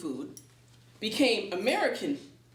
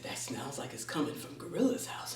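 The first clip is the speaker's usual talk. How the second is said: whispered to himself, no voice; 9 semitones lower, 6 dB quieter than usual. Acted. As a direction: breathe it as a secret guess. Anansi muses to himself.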